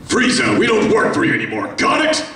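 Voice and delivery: tough defiant warrior, pushed up and quick; Bardock line. Shown as a demonstration. Saiyan warrior defying Frieza, tough and defiant. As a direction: spit it at the tyrant like a badass.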